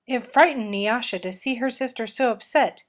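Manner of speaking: usual speaking voice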